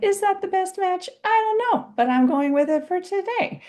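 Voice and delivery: high sing-song mock indecision; playful self-doubt. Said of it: "I'm like".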